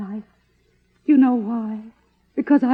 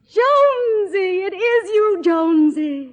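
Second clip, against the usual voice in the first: higher, louder, slow cry of recognition; tearful delight; 9 semitones higher, 7 dB louder, slower than usual. Acted. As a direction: choke up with joy at an old friend. Star greets old gatekeeper tearfully.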